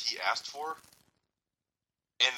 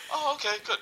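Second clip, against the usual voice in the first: mock cheerful doctor; 12 semitones higher, 3 dB louder than usual.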